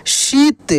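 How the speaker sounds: शीत with the ī held long; drawn-out long ī. Shown as a demonstration. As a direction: stretch the ī in शीत. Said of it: "इसका उच्चारण खींच कर होता है". (शीत की ई खींच कर)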